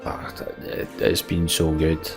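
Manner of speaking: usual speaking voice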